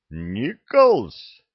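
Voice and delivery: loud questioning repeat of a surname; barked query. A narrator's reading. Master sergeant querying the recruit's name, barking. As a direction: throw the name back, loudly.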